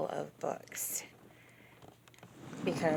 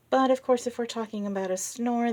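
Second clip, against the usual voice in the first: ordinary delivery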